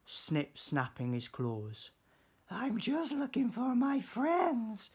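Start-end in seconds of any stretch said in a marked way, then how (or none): 2.5-4.9 high little-fish voice; Stanley explains to the lobster, high voice; little fish explaining. Acted. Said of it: "said Stanley".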